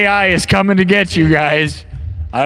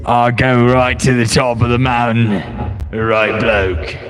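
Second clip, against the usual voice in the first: mock British accent; 5 semitones lower than usual. Vlogger mocks a British-looking AI Bryan, in a mock British accent. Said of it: "Is that what he says?".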